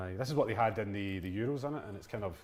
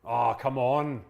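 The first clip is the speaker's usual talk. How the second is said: yelling from the sidelines; 6 dB louder, much slower than usual. Real, not acted. Protesting a decision while watching.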